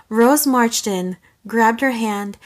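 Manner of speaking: usual speaking voice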